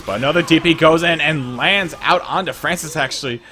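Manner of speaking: fast, loud, high esports casting; hyped play-by-play. Real, not acted. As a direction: call the action at full hype. Excited live play-by-play.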